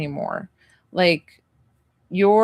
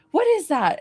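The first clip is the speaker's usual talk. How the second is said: a mom's high, startled "what is that"; lightly voiced mom catching her son's doodle; suspicious mom; 9 semitones higher than usual. Mother spotting a drawing on her son, mock alarm. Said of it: "and you're like".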